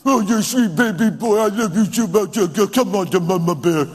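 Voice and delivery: put-on gushing parent cooing to a baby; mock baby talk. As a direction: run the words together in silly cooing. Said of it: "don't talk baby talk".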